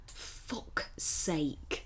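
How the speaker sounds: whispered, exasperated fuck's sake; muttered under breath. Retold or imitated. Writer grumbling under his breath at the screen, whispered.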